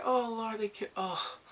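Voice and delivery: high, loud, breaking-off cry; distraught lament. Retold or imitated. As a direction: lament it loudly, too shocked to finish. Distraught at truck death scene.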